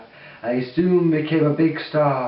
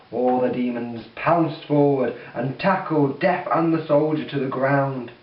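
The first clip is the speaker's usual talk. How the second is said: ordinary delivery, nothing marked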